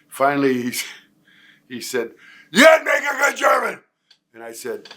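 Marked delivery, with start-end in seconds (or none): 2.5-3.8 put-on high, loud barked German accent; shouted imitation in a German voice; mock German accent shout. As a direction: bark it like a stern German sergeant. Drill sergeant mocking him.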